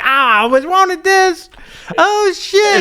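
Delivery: high loud yelp as the thrilled son; excited son. Retold or imitated. Host acting out the son in the documentary, loud high mock excitement. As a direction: shout it like an overjoyed kid. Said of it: "his son's like".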